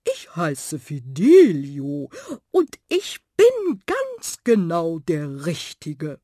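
slow, loud, grandly drawn-out suitor; proud tomcat. Acted. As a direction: declare yourself with a flourish. Tomcat proudly asserts himself. (langsamer, stolzer Kater)

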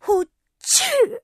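loud high sneeze; a big fairy sneeze. Acted. Fairy sneezes loudly, high loud sneeze.

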